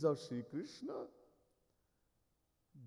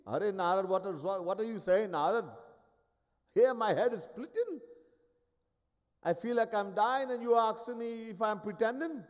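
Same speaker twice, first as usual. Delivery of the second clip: pained protest; 5 semitones higher than usual. Acted. Krishna insists he is dying.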